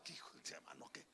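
soft unvoiced whisper; whispered aside to the congregation. Real, not acted. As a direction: breathe it as an aside.

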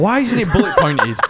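exasperated question broken by laughter; giggling at the absurd books; laughing rant. Retold or imitated. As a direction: laugh through a mock complaint. Mock rant about exam books.

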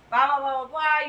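loud mock rambling; loud chatter. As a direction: imitate yourself talking at full volume. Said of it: "You know me, I'm loud".

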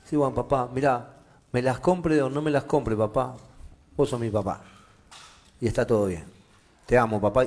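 low, soft and reassuring; accepting son. Acted. Son answers dad about the sneakers, softly accepting.

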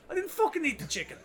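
high-pitched, indignant mock yell at the counter; angry retort. Drunk customer tells chipper worker he didn't eat it, indignant high mock yell. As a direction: protest it, voice shooting up high.

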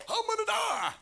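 very high, loud panicked shout; hammed-up freak-out. Preacher voicing Elijah terrified of Jezebel, mock freak-out. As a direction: exaggerate a panicked squeal at full volume. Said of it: "Elijah freaks out".

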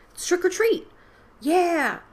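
high, loud gleeful cheer; excited shout. Acted. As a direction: yell it with glee. Boys cheering trick or treat.

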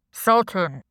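loud electronically disguised voice; anonymous boss on a video call; deep, distorted voice. A narrator's reading. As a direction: greet him through a voice changer. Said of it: "he said in a deep, distorted voice".

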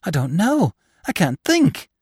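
high, anxious, helpless reply; murmured bewilderment. A narrator's reading. Pale nephew answers his startled aunt, anxious and bewildered. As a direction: answer helplessly, pitch climbing.

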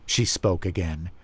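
plain reading voice

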